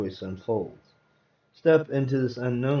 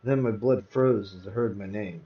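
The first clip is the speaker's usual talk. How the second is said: plain reading voice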